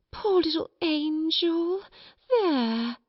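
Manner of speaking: high, slow, syrupy soothing; cooing comfort. A narrator's reading. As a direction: pet a screaming child with pity. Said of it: "first one method and then another".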